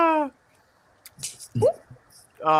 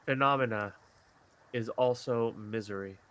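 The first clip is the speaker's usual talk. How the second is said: poem reading; 6 semitones lower, 9 dB quieter than usual. Acted. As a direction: read it like a tender poem. Reads his poem aloud.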